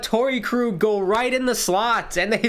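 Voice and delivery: high-pitched look at that again; can't believe they scored again; incredulous, breaking into a laugh; exasperated disbelief. Real, not acted. Another goal conceded, disbelief.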